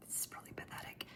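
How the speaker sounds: hushed quick confession; whispered aside. Real, not acted. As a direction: mutter it to yourself, embarrassed. Self-deprecating aside.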